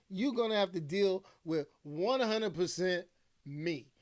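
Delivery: ordinary delivery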